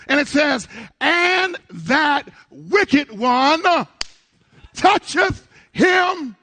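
loud, high, rousing sermon shout; belted preacher's proclamation, key words stretched out; full-force preaching. Real, not acted. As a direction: drive it home to the whole congregation.

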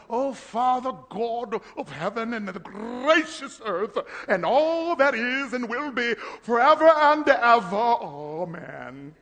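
hammed-up flowery prayer voice; mock pretty prayer. Preacher mocking showy prayers, hammed-up flowery. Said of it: "I don't try to get pretty".

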